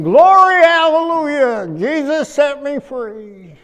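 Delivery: loud, high hallelujah burst then slowing; shouted praise. Retold or imitated. Praising God out loud over an empty row, jubilant. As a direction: erupt in revival joy, then ease off laughing. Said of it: "I'm praising God and saying".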